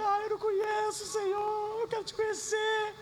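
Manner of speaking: high, soft, weeping plea; retold sobbing prayer, high and soft; tearful prayer. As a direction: cry out to God through tears. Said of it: "eu comecei a chorar mais ainda".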